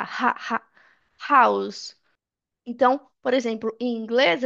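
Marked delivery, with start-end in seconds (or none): 0.0-0.6 "ha, ha" as two separate breathed H syllables; isolated H sound. Shown as a demonstration. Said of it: "Olha o som do H". 1.3-2.0 "house" with a clear breathed H; H sounded. Shown as a demonstration. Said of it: "Olha o som do H".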